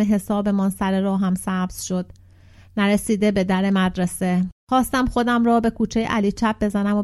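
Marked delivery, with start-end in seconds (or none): none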